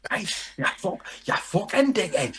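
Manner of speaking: high-pitched mock Geordie insult; attempted Geordie accent. Shown as a demonstration. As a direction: hurl it in a hammed-up Geordie. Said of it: "I can't do Geordie".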